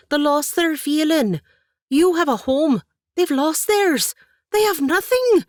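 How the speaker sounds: high, loud, building to a cracking cry; rising impassioned plea. Acted. Katie pleads for the widows to her aunt, building loud. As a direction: climb with every line until the voice breaks. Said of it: "increasing in volume as she spoke".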